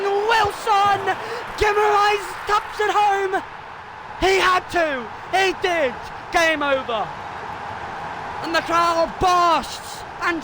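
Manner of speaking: high, drawn-out play-by-play shouting; ecstatic goal call. Real, not acted. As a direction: call the winning goal at full voice. Calls decisive goal live.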